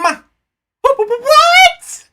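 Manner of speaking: very high, stuttering gasp of fear; scared stammer. Acted. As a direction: stammer it out terrified. Witness stammers in fright.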